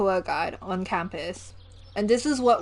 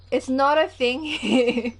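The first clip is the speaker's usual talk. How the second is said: slower, higher, stressed "a thing"; emphatic; 4 semitones higher, 5 dB louder, slower than usual. Real, not acted. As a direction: insist "It's not a thing here". Emphatic repeat of claim.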